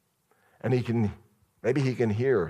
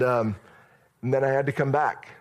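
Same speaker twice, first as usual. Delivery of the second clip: ordinary delivery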